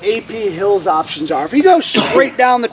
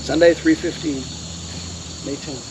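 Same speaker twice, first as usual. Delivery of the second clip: very low, soft, spaced-out phrases; hushed solemn announcement; 9 semitones lower, 11 dB quieter than usual. A narrator's reading. Lecturer announces Jackson's death, hushed and solemn. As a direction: let it fade almost to nothing.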